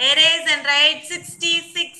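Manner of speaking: measured, drawn-out classroom instruction; slow dictation. Real, not acted. Teacher dictating the next number.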